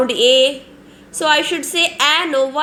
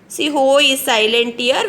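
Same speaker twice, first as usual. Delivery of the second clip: normal talking voice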